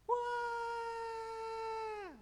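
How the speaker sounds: high mock wail of a crying child; imitated child wail. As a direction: wail like a small child for a moment. (tiruan tangisan anak kecil yang melengking)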